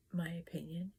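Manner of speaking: normal talking voice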